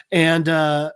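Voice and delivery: ordinary talk, nothing marked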